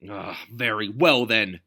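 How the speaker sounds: Notley throwing up his hands; exasperated exclamation. A narrator's reading. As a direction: give in with huffy grandeur. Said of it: "he exclaimed".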